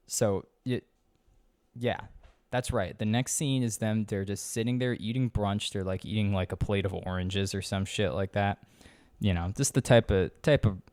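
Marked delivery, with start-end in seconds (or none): none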